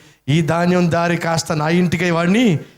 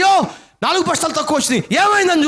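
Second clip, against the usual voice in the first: alarmed cry; 12 semitones higher, much faster than usual. Acted. Farmer finds sacks missing.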